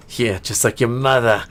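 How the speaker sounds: cheeky elf jab. Acted. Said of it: "the elf says".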